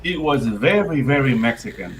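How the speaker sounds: normal talking voice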